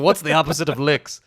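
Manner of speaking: lightly voiced Irish question; a hint of Gleason's Irish lilt; mock Irish accent. Quoting Gleason to a co-host, light Irish lilt. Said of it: "He's like".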